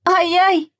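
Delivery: high yelp of pain; sharp ouch. A narrator's reading. Sasha is stung by nettles and yelps. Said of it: "закричал Саша".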